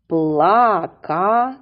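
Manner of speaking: "Плакати" in three slow, separate beats, Пла-ка-ти; syllable by syllable. Shown as a demonstration. Teacher dividing the word for children, slow separated syllables. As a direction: break "Плакати" slowly into PLA, KA, TY. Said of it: "Ділимо слово на склади".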